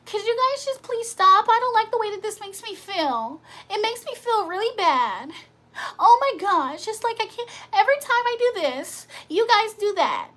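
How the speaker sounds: high, whiny mock complaint voice; mock whiner. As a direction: whine it like a sulky kid begging. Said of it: "Whining is complaining and saying".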